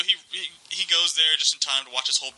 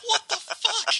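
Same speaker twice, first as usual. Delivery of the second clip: much higher stunned disbelief; shocked; slower than usual. Retold or imitated. Host reacting to the kid spoiling the movie, shocked. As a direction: react like you can't believe it. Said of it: "I was like".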